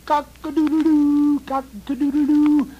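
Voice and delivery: high, loud crowing; imitated rooster crowing. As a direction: crow like a rooster. Cocks crowing.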